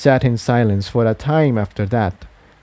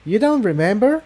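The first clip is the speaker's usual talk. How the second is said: higher mare voice, surprised question; a young mare voiced higher, startled; higher-pitched filly asking in disbelief; Tiptoe; 11 semitones higher than usual. A narrator's reading. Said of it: "Tiptoe let go of Jigsaw and said".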